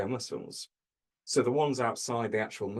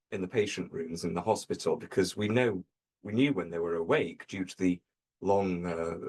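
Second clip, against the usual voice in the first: usual speaking voice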